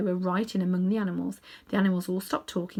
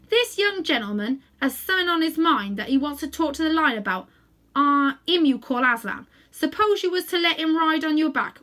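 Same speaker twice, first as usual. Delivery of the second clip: louder, coaxing Cockney cabbie; 6 semitones higher, 7 dB louder than usual. A narrator's reading. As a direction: coax the old horse like a London cabman. Cabbie asking horse.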